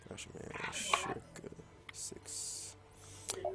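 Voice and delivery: muttered count under the breath; whispering. Real, not acted.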